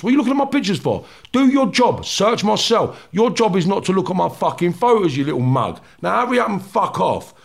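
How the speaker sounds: high-pitched, heated retold rant; angry telling-off. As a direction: berate the guard, punching out each insult. Retold row with prison officer.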